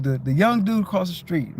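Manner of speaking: ordinary talk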